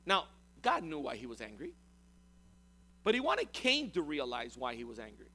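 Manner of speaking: ordinary talk, nothing marked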